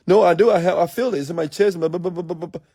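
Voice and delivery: high, rattled-off complaint, loud at first; mock protest. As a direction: imitate an excuse dissolving into babble. Mocking an anxious person's protest.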